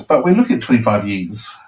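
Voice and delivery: ordinary talk, nothing marked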